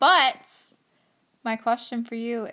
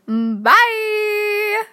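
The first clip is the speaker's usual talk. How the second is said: high, loud, drawn-out farewell; called-out goodbye; 11 semitones higher, 10 dB louder than usual. Pretend. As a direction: sing out the sign-off. Calling out the sign-off.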